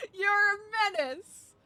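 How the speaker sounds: a hammed-up telling-off, slowly; mock scolding. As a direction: pretend to be appalled at them. Mock scolding the friend again.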